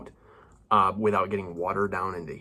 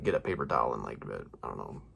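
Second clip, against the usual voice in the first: usual speaking voice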